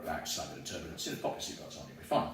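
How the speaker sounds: low, soft reassuring captain; calm pilot. Retold or imitated. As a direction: settle a bouncing plane calmly. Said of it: "you need the pilot to go".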